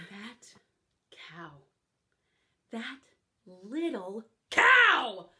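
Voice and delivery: resentful mutter into exclamation; indignant grumble. Acted. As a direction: whisper then slow loud spit of little cow. Fairy godmother grumbling.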